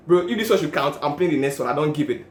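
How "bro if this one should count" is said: ordinary talk, nothing marked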